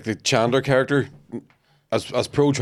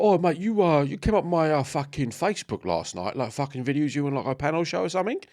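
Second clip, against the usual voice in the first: higher-pitched mock Cockney accent; Cockney bodyguard; 6 semitones higher than usual. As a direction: imitate a matey Cockney bodyguard. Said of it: "big Cockney bodyguard, was like".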